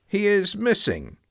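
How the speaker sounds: usual speaking voice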